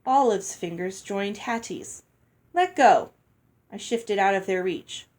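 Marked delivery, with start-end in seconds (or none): 2.5-3.1 sharp, loud command. A narrator's reading. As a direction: snap it out to protect it. Snatching back mother's necklace.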